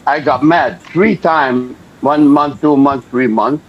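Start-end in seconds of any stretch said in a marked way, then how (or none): none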